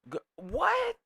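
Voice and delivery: very high, loud rising what; shocked what. Real, not acted. Hearing the OnlyFans claim, a shrieked high what. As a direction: shriek the question in disbelief. Disbelief at rumor.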